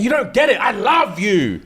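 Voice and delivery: high, wounded pleading; mock anguished husband. Jealous husband pleads with wife.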